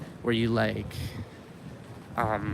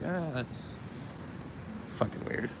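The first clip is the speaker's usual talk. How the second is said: hushed aside; 11 dB quieter, slower than usual. Retold or imitated. Uneasy remark on the waitress.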